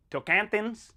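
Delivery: Americanised Tocantins, short last vowel, as a question; American pronunciation. Shown as a demonstration. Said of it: "how you Americans say it".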